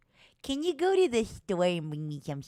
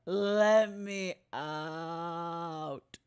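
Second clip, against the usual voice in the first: mock despairing plea; 4 semitones lower, 5 dB quieter, much slower than usual. Pretend jail plea.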